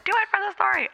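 high, small voice in the head; soft, high-pitched nudge from an imagined friend; Ricky. Retold or imitated. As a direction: pipe up quietly like a nagging inner voice. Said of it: "got Ricky in the back of my head".

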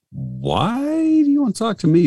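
very high, squeaky incredulous question; mock disbelief. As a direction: act baffled at being picked, voice shooting right up. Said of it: "I'm like".

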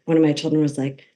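ordinary talk

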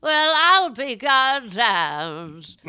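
loud, very slow, drawn-out impression; Katharine Hepburn. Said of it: "I just do Katharine Hepburn".

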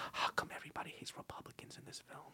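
put-on girlfriend's hushed movie question; very soft mock cinema whisper; imitated whisper. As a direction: lean in and whisper it. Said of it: "she leans over, she goes".

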